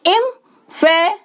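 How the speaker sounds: Emphasizing in four separated chunks; syllable by syllable. Shown as a demonstration. Teacher repeating for learners, emphasizing said in separate syllables. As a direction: break emphasizing at each syllable. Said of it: "शब्द को तोड़कर sound change".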